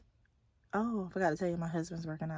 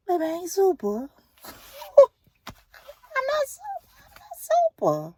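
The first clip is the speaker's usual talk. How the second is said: much higher and louder, laughing through it; laughing high-pitched protest; laughing denial; 11 dB louder, slower than usual. Real, not acted. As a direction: squeal it out while laughing. Laughing self-talk, denies being super.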